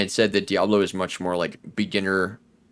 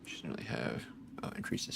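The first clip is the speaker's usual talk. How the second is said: whispering; 6 semitones lower, 13 dB quieter than usual. Real, not acted. Reading item stats quietly.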